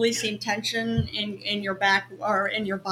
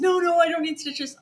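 higher-pitched mock refusal; imitated protest; 6 semitones higher, 4 dB louder than usual. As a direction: mimic a stubborn patient refusing care. Acts out his refusal of help.

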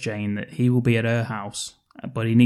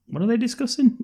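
much higher, teasing question; mock curious; 11 semitones higher, faster than usual. Co-host teasing about the date, high and curious. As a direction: pitch it up like a gossip.

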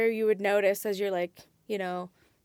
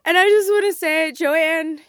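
laughing; 7 semitones higher, 13 dB louder than usual. Real, not acted.

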